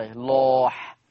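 لاح with a thick, dark L, held long; heavy lam. Shown as a demonstration. Teacher drills the syllable for students, heavy lam in لاح. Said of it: "لا مغلظة".

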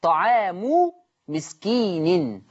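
طعام مسكين drawn out slowly in Quran recitation; slow recited reading. Shown as a demonstration. Teacher slowly reciting طعام مسكين for students. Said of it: "عندنا كلمة فدية".

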